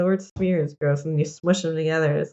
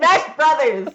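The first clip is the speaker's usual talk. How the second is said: mock announcer shout; 8 semitones higher, 6 dB louder, slower than usual. Announcer-style shout, then Ganondorf.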